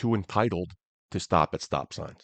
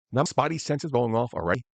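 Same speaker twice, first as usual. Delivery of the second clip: ordinary talk, nothing marked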